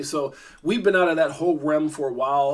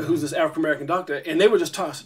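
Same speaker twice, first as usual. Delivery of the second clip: normal talking voice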